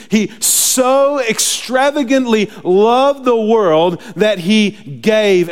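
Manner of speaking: slow, high, loud proclamation; fervent preaching. Real, not acted. Preacher magnifies God's love for the world, fervently drawn out. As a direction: stretch each word with swelling fervor.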